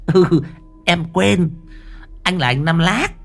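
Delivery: high, sing-song whine; whiny effeminate voice. A narrator's reading. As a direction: camp it up, high and coy. Same effeminate character.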